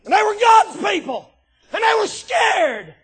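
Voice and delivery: high, loud pulpit shout; full-force preaching. Real, not acted. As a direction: hammer it at the congregation.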